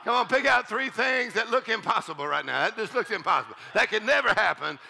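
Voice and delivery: normal talking voice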